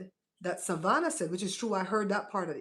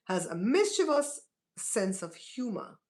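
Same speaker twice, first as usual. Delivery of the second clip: ordinary talk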